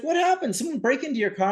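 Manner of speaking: high-pitched imitation of an amused cop. Laughing cop greets his neighbor.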